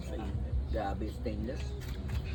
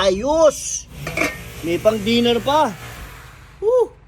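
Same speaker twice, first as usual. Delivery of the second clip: excited cheering; 7 semitones higher, 6 dB louder, much slower than usual. Real, not acted. Celebrating leftovers for dinner.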